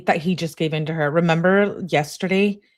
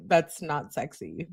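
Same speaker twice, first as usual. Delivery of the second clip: ordinary talk, nothing marked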